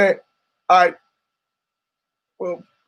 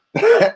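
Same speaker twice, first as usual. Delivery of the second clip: high squealing laugh; 3 dB louder than usual. Real, not acted. Laughing at his own joke.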